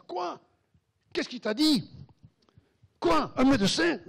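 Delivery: shocked outburst. Retold or imitated. Wife hears the doctor's verdict for the first time, high shocked cries. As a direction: shriek it out in disbelief.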